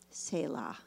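"Selah" said SAY-lah, low and quiet; first version, "Selah" as SAY-lah; pronouncing Selah. Shown as a demonstration. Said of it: "I don't know how you want to pronounce it".

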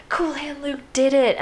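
high, slow, drawn-out marvelling; admiring cheer. Retold or imitated. Inmates marvelling at their escaped hero, admiring cheer. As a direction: imitate awed fans, pitched well up. Camp celebrates the escaped man's photo.

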